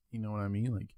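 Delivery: ordinary delivery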